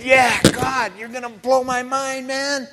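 high, eager, thrilled tone; excited. Retold or imitated. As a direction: gush like you can't wait for God to act. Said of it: "One minute I'm excited".

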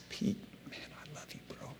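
whispered, soft and intimate; hushed loving words to Peter; tender whisper. Acted. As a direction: breathe it gently like a friend. Jesus voiced tenderly to Peter.

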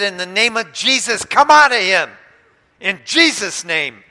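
preacher casting out demon, shouting; commanding shout. Retold or imitated.

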